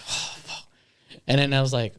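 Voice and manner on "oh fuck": voiceless, exhaled curse; dismayed sigh. Retold or imitated. Upset Johnny hearing they are still filming, deflated whisper. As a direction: breathe the curse out very softly. Friend's dismayed reaction, imitated.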